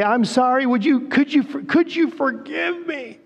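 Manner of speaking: high, halting plea for forgiveness; pleading apology. Retold or imitated. The accuser begging James for forgiveness, halting and high. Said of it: "walk next to you and say".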